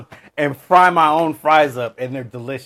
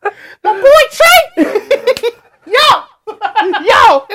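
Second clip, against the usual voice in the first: laughing outburst; 6 dB louder, much slower than usual. Real, not acted. Laughing outburst over his team.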